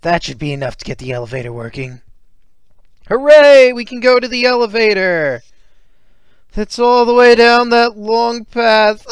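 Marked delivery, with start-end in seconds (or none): 3.1-5.4 high, loud cheer. Acted. Cheer at elevator working. 6.5-9.1 exasperated. Acted. Dismay at long walk.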